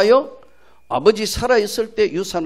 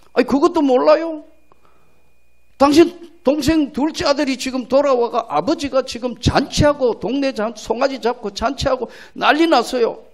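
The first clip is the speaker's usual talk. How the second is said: higher, lively servant telling the news; a servant blurting out the news; servant's reply; 6 semitones higher than usual. Acted. Servant tells elder son of the feast, higher and lively.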